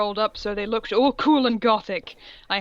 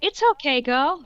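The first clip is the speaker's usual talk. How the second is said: put-on girly friend voice; 6 semitones higher, 4 dB louder than usual. Voicing Bloody Mary.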